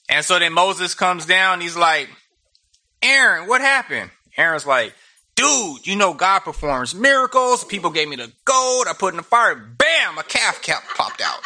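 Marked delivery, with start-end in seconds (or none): 3.0-4.1 high, incredulous confrontation; hammed-up disbelief; mock exasperated demand. Moses confronts Aaron over the calf. 5.3-11.4 high-pitched, animated mock defence; hammed-up innocent excuse; comic excuse-making. Aaron makes excuses to Moses.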